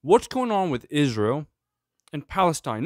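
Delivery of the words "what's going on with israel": usual speaking voice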